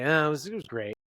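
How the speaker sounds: hesitant, half-hearted enthusiasm; disappointed. Shown as a demonstration. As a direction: let the letdown show through polite words. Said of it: "you're sort of disappointed".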